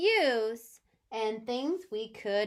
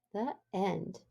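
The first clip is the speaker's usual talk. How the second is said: a lightly voiced storybook read for little kids; reading to children; 6 dB louder than usual. Teacher reading a story to her class, gentle storybook voice. Teacher reads picture book aloud.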